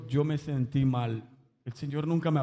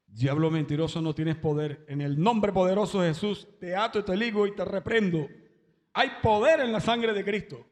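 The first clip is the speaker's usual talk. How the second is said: rebuking the devil; 5 semitones higher than usual. Retold or imitated. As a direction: cast out the devil, rising to full force. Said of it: "que reprendían".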